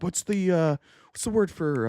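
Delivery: imitating a show-off pretending to forget English; a hint of a smug returnee fishing for a word; mock returned missionary. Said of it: "they would do this".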